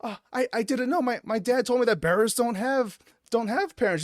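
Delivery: put-on high, stammering child's excuse; little girl. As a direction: play a flustered little girl defending herself. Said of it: "The girl's like".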